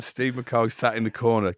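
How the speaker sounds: ordinary delivery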